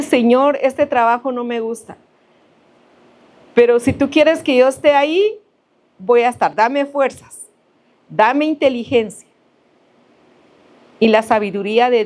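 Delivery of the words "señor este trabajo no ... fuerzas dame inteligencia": raised, earnest pleading prayer; model prayer. Shown as a demonstration. Preacher voicing a worker's prayer, earnest and raised. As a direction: pray it aloud for them.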